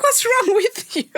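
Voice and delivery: very high, drawn-out mock question; mock high-pitched voice. Sister retelling row over brother's breaking voice, mock squeal. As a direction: squeal it as a teasing imitation.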